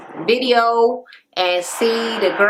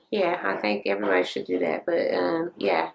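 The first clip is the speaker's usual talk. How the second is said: low mutter; 4 semitones lower, 8 dB quieter than usual. Real, not acted. Muttered aside after recap.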